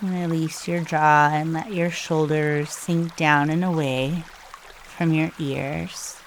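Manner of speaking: soft, hushed meditation guide with long pauses; calm, unhurried breathing instructions, spoken softly; guided meditation. Real, not acted.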